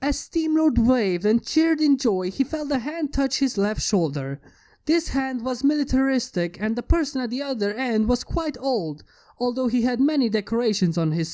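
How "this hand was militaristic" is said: usual speaking voice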